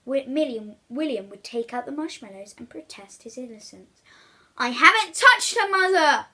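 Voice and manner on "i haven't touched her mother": put-on high, loud pleading boy. William pleading innocence to Mother, high and loud. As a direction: beg to be believed. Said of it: "the boy would plead".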